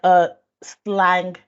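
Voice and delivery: ordinary delivery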